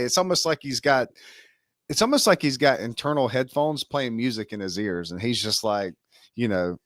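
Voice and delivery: ordinary delivery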